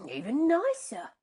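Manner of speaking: high, loud delight; delighted cry. Acted. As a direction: savour each new taste. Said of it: "he cried".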